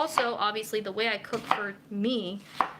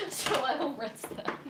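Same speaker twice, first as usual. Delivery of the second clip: ordinary talk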